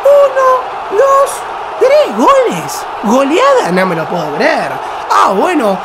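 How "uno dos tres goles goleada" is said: high, shouted disbelief; excited shout. Real, not acted. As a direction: shout the count of goals. Third goal celebration.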